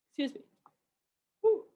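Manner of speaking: speaking through a yawn. Real, not acted. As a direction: yawn while saying it. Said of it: "Yawning too".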